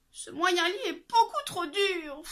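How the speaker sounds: put-on high girlish complaint; a touch of weary sigh; lightly voiced girl complaining. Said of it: "soupira-t-elle".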